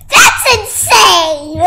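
slow, loud, laughing exclaim; excited laughing. Real, not acted. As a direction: burst out laughing at it. Sudden surprise in game.

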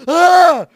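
very high, blasting shriek; real scream of sudden fright; terrified scream. Real, not acted. Player scared at the end of the game, terrified scream.

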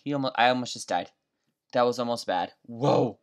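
normal talking voice

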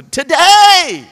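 high, loud and slow cry of "today"; preaching shout. Real, not acted. Preacher calling the congregation to act now. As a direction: drag "To, today!" out in a big cry. Preacher hammering his point.

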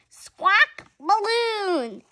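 high, slow, loud squawk; loud parrot screech. Acted. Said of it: "says Polly Parrot".

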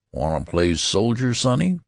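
lightly voiced clerk, rising on sonny; a hint of a teasing shop clerk; offhand question to a boy; casual clerk. A narrator's reading. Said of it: "demanded the clerk casually".

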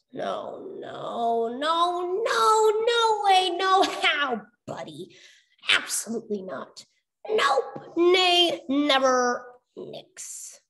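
emphatic refusal. A narrator's reading.

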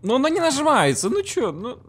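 high, loud exasperated whine; frustrated high outburst. Real, not acted. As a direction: complain in a squeaky burst. Frustrated at stuck button.

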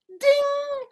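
high, ringing held ding; bell imitation. Shown as a demonstration. Showing kids the starting bell, ringing ding. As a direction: ring it out like a bell. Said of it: "just like that".

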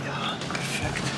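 hushed whisper from a hiding spot; whispering while hiding. Real, not acted. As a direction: whisper so the seeker can't hear. (fluisterend vanuit een verstopplek)

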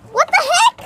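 high, loud yelp; shocked. Real, not acted. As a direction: blurt it out in shock. Sour candy shock.

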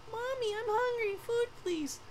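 put-on high little child begging for food; a small hungry kid pleading with mom. Hungry child asks mother for food, high childlike voice.

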